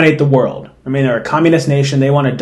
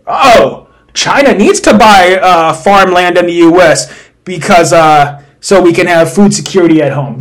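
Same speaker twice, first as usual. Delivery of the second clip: exclaimed mock realization, raised and louder; mock gullible reader; 3 semitones higher, 4 dB louder than usual. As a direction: play someone falling for propaganda. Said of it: "you read that and you're like".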